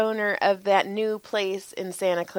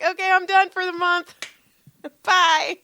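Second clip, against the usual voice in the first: pretend breezy goodbye; 10 semitones higher, 4 dB louder than usual. Imagining quitting after earning month.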